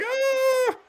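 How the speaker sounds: high-pitched mock scream; long, high "aah" of a startled crowd; imitated crowd scream. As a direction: imitate a crowd shrieking at fire. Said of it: "everyone's like".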